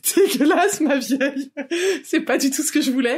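high, laughing disgust. Retold or imitated. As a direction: squeal out the disgust. Retold friend's reply, acted.

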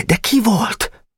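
high, slow pleading question; small boy desperate to know; young Nemecsek pressing; eager boy. Acted.

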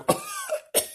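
high thin put-on cough; ghostly cough. Ghost husband answering from the other room with a cough. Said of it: "he's in the other room going".

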